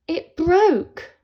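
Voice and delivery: the guitar snaps, cry out; dismayed cry. A narrator's reading. Guitar breaks.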